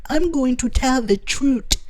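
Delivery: high-pitched mimicry with "truth" as "troot"; mispronounced "truth". Vlogger replaying the doctor's slip for listeners, "truth" as "troot". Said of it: "He didn't say truth. He said".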